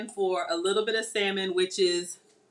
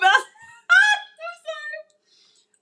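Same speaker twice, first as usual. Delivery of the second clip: laughing; 8 dB louder, much slower than usual. Real, not acted. Laughs after echoing husband.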